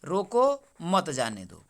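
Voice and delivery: slow, pause after रोको, then मत जाने दो together. Shown as a demonstration. Teacher showing where the comma falls, pause after रोको. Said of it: "यहाँ पर विराम लिया".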